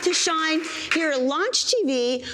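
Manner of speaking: high, slow welcome-back read; show host intro. Acted. Host's show opener.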